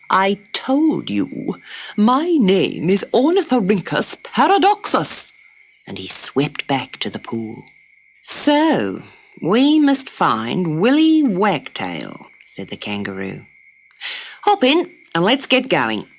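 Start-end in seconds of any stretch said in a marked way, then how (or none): 0.1-5.3 offended platypus. Acted. 8.2-12.3 slow, decided; a gentle hint of resolve; lightly voiced kangaroo. The kangaroo tells Dot the plan, lightly voiced. 14.4-16.1 higher, brisk invitation; a touch of bustle; cheerful kangaroo. Acted. The kangaroo urges Dot into the pouch, brisk.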